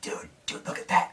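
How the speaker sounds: hushed urgent whisper to a friend. Retold or imitated. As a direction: whisper it, nudging a buddy. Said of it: "he's like".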